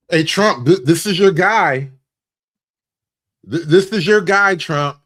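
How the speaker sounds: loud, emphatic call to someone absent. Pretend. Comedian hailing Trump about his guy, calling out loud. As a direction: call out loud and punchy to get his attention.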